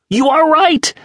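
very high, loud excited declaration; exultant reveal; triumphant. A narrator's reading. James triumphant on the phone.

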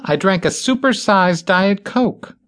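high, slow, stressing "super-sized Diet Coke"; high pleading "Diet Coke"; desperate explaining. A narrator's reading. Bitsy explains her need.

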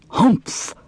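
high snorting humph; grumpy camel snort. A narrator's reading. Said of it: "he said".

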